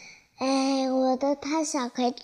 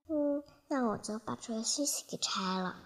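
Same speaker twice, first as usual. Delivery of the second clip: whisper; 4 semitones lower, 14 dB quieter than usual. Acted. Toy threatens to take star off.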